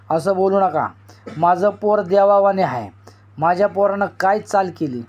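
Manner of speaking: ordinary delivery, nothing marked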